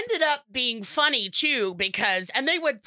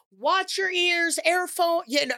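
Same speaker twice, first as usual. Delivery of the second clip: retold yelled warning; 6 semitones higher than usual. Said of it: "So I just yelled out".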